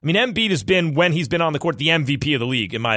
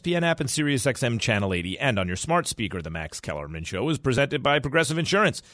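ad read; 4 semitones lower, 4 dB quieter than usual. Acted. Fast ad read.

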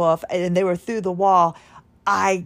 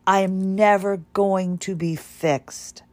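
usual speaking voice

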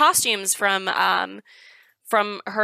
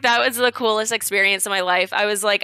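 usual speaking voice

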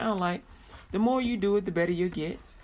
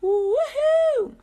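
high, loud whoop; showy burst of excitement; excited voice; 7 dB louder than usual. Shown as a demonstration. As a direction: let out a big high cheer. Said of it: "That's my excited voice".

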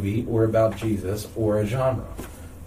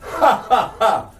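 fake laugh; 8 semitones higher, 4 dB louder, slower than usual. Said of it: "See what I did there?".

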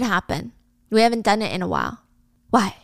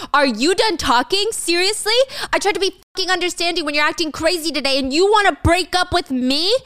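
higher, angry yelling at her; screaming; 7 semitones higher than usual. Retold or imitated. Michael grabbing her arm in the alley, yelling. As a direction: shout your outrage at being dumped. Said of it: "is screaming in the alleyway".

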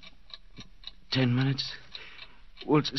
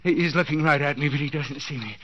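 ordinary talk, nothing marked

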